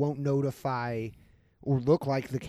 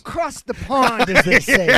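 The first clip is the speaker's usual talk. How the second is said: slow, higher, louder toff voice; mock posh gent; 10 semitones higher, 12 dB louder, slower than usual. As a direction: play a pompous Brit.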